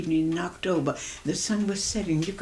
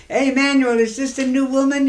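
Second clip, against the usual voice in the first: loud hearty hail, pitched up; calling out; 6 semitones higher, 7 dB louder than usual. Retold or imitated. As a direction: call across the street to a friend. Neighbour calls out from his house.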